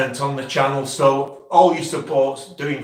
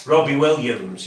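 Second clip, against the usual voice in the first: ordinary talk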